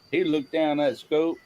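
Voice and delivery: normal talking voice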